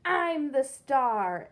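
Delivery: loud, slow jealous cry; shrieking. Acted. Pig shrieks at Trevor in jealousy, loud slow cry. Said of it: "He shrieked".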